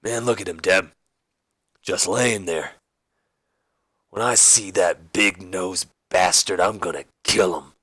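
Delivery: lightly voiced brooding wrestler; slow, grudge-bearing vow in a put-on voice; Stone Cold Steve Austin. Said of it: "And Austin goes".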